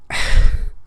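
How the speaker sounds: weary, audible exhale; exasperated sigh. Real, not acted. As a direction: sigh as if fed up. Exasperated pause in rant.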